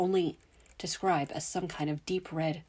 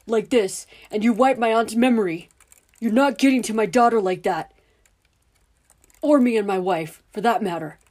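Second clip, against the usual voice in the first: loud, higher, furious tirade; angry shouting; 4 semitones higher, 11 dB louder than usual. Acted. Father yelling at the men to protect his daughter. Dudley confronts the uniformed men.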